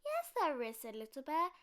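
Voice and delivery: high, loud small cub voice; a cub insisting; insistent little cub. A narrator's reading.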